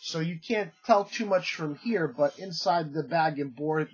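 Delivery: usual speaking voice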